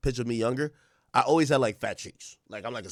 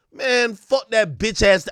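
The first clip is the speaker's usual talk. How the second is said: mock diss; 7 semitones higher, 5 dB louder, slower than usual. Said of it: "it's never".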